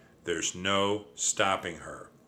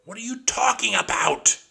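high, loud exasperated scream; 13 semitones higher, 7 dB louder than usual. A narrator's reading. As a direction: scream it from the chair, fed up. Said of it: "climbed onto my chair and screamed".

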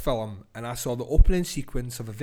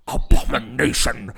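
Bill Nighy impression; 4 semitones lower, 4 dB louder than usual. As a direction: lightly echo Bill Nighy's disgusted "abomination". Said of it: "Bill Nighy's disgust verbalized into one word".

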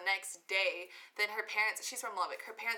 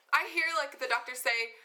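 usual speaking voice

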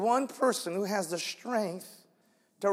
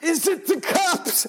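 scared disciples; 6 semitones higher than usual. Retold or imitated. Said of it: "they're like".

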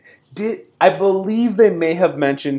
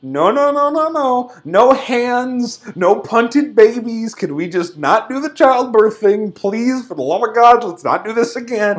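raised pitch, begging them to stop; exasperated pleading; 5 semitones higher than usual. Retold or imitated. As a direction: plead through your mock exasperation. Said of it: "I'm like".

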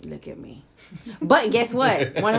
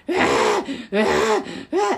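imitated whiny wailing in bursts; mock child's crying; 5 semitones higher than usual. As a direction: wail like a fussing toddler.

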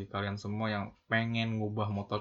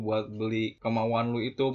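usual speaking voice

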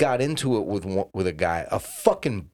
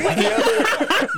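laughing; 4 dB louder, slower than usual. Real, not acted. Laughing mid-story.

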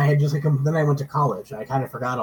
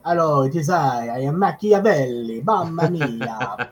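light hammed-up Italian merchant voice; jolly Italian pizza chef; 3 semitones higher, 4 dB louder than usual. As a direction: playfully ham up a Venetian showman. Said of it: "wandering around and going".

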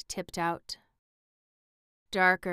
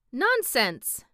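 curt, higher, louder dismissal; sharp; 6 semitones higher, 6 dB louder than usual. A narrator's reading. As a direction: cut it off sharply. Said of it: "said the Scarecrow sharply".

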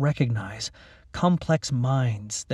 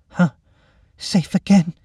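dry relieved voice; 6 semitones higher, 5 dB louder than usual. Acted. Creature girl reacts to rescue.

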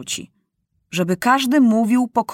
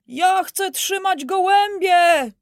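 put-on child shouting; 9 semitones higher, slower than usual. A narrator's reading. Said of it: "krzyczy jeden".

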